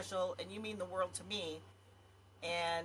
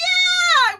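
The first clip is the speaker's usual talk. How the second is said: excited yell; 21 dB louder than usual. Retold or imitated. Cheering on a water slide ride.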